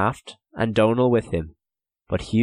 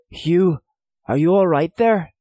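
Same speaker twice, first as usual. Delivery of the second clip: higher, louder character voice; anxious call; 10 semitones higher, 7 dB louder than usual. A narrator's reading. Donal checks on Hugh in the dark, raised anxious call.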